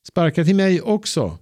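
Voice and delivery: put-on high, eager children's shout; calling out. A narrator's reading. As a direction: yell to be let into the game. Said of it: "ropar Ebba och flera andra barn". (ivrigt rop, högt och ljust)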